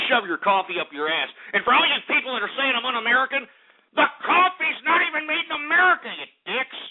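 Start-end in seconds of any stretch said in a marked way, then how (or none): 4.0-6.8 ranting insult. Acted. Ranting at his critics.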